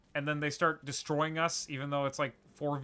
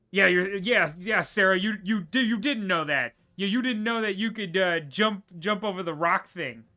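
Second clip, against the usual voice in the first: loud, higher teasing; excited teasing; 5 semitones higher, 8 dB louder than usual. Real, not acted. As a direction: rib her loudly, grinning.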